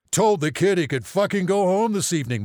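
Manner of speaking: loud, high-pitched outrage; angry father. A narrator's reading. Father complaining to the family doctor, outraged. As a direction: complain loudly like a fuming father.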